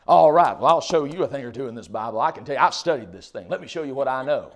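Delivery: loud, cocky announcement; show-off. Acted. Bible student out to show others up, loud and boastful. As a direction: burst in loudly, boasting of what you know. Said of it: "studying so I can go and say".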